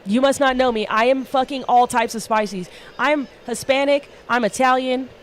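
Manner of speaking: ordinary delivery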